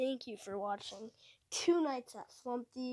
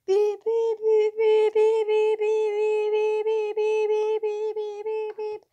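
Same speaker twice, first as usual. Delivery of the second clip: loud, higher beep beep beep, steady; loud mock beeping; 8 semitones higher, 12 dB louder, slower than usual. Gamer beeping along while rebooting systems, loud and high. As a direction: belt the beeps like an alarm.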